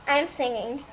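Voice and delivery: normal talking voice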